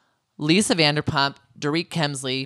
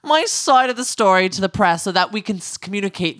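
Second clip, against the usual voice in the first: imperious mock-royal proclamation, rising higher; mock queen; 4 semitones higher than usual. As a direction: play someone who thinks they rule England. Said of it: "thinking they're literally Queen of England".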